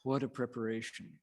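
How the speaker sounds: normal talking voice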